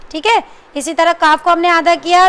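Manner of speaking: normal talking voice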